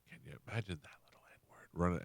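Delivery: hushed, voiceless whisper; old man's whispered slur, retold; whispered aside. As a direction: lean in and breathe it like a secret. And only he said the N-word.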